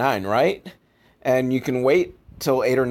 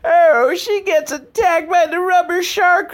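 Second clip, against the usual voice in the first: high, slow mock-dramatic gasp of doom; mock horror; 4 dB louder than usual. Narrating the mother's shark attack in hammed-up horror. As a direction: ham up the shock like a B-movie.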